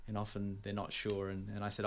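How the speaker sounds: ordinary delivery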